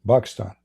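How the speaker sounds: Pakistan said as "Bakistan", b instead of p; Arabic pronunciation. Shown as a demonstration. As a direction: swap the P for a B: Bakistan. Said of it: "In Arabic, we don't have P".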